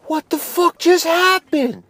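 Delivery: high-pitched, drawn-out mock disbelief; shell-shocked. Stunned host asking what Joe just did, high mock shock. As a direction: imitate a stunned friend in a slow, high voice. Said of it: "shell-shocked".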